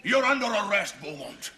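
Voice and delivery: barking orders, high and loud; shouting. Acted. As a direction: bellow the arrest. Sergeant major arresting Beaumont.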